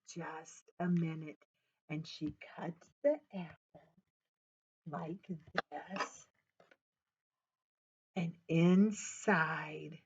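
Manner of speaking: storyteller hushing for the big reveal; hushed reveal. A narrator's reading. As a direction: whisper it like a secret. Mom reveals the star.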